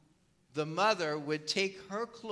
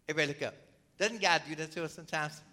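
ordinary talk

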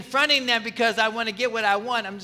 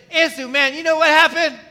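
higher and louder, calling him out in front of everyone; public confrontation; 8 semitones higher, 9 dB louder than usual. Pretend. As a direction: confront a friend loudly before the crowd. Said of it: "I'm confronting him right here".

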